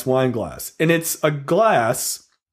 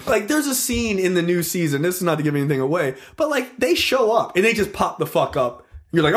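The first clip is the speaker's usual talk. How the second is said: ordinary talk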